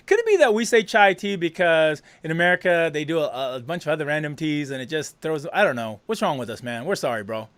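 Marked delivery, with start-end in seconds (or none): none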